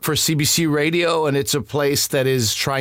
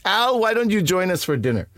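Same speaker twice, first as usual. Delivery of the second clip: friend's mother; 6 semitones higher than usual. Retold or imitated. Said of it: "coming from Missus Moose after the Al".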